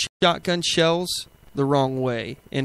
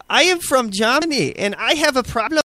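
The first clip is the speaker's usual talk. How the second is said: higher-pitched, hammed-up mock German accent; horrible German accent; 7 semitones higher than usual. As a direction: imitate an indignant commenter in a bad German accent. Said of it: "that was a horrible accent".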